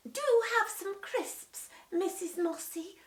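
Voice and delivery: put-on high, slow sugary child voice; polite goody boy. Peter offering crisps to guest, sweet high voice. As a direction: lay the politeness on thick.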